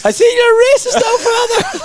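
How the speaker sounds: high-pitched Father Ted character quote; Father Ted impression. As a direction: squeal it in a mock Father Ted voice. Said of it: "a Father Ted impression".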